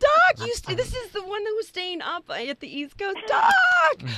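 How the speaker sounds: high squealing delight; excited squeal. Real, not acted. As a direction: shriek with joy at a friend. Greets caller excitedly.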